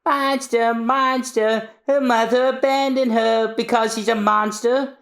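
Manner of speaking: high mocking chant; children mocking high and sing-song; taunting kids. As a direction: jeer at the outcast girl.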